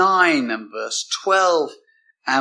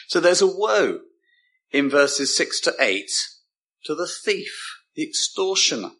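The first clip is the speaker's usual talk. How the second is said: ordinary talk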